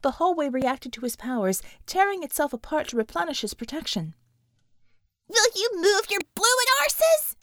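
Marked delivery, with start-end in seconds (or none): 5.3-7.4 high, loud British yell; shouting. Acted. Bella yelling at the team to move, high and loud. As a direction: bark at them to hurry. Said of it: "Bella shouted".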